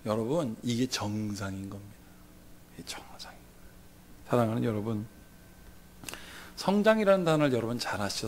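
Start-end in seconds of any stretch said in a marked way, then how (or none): 2.7-4.3 이게 정상인 거예요 breathed as a whisper; soft whispered aside. Shown as a demonstration. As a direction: drop to a hushed whisper to stress the point. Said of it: "repeated as whisper".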